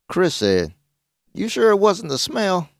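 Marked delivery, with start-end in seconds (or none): 1.3-2.7 high-pitched mock doubt; teasing, pitched-up query; teasing question. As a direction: needle a friend with a joke. Teasing joke, answered by fake laugh.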